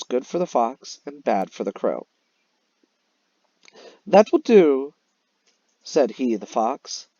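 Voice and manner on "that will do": louder, higher smug fox. Acted. Fox snapping up the cheese, smug and loud. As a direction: gloat over the prize.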